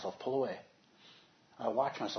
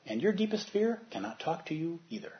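ordinary talk, nothing marked

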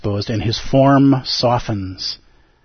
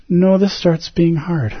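dismayed realization; 6 semitones higher, slower than usual. Retold or imitated.

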